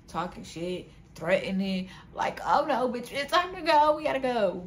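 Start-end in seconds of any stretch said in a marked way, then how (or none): 2.4-4.6 higher, louder rush to get out; retold urgent alarm. Woman telling her friends it's time to go, retold urgency. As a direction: raise the alarm, pitch climbing. Retells urgent panic to leave.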